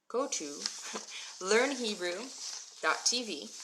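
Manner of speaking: broken into short chunks with pauses. Shown as a demonstration. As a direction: spell out the web address piece by piece. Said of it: "Okay, I'll say it slower".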